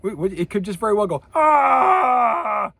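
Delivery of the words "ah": loud, high sustained yell; Jerry Stiller. Shown as a demonstration. Lecturer guessing at a T-Rex roar, comic high yell. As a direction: holler one long open cry as a mock T-Rex. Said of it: "Like Jerry Stiller".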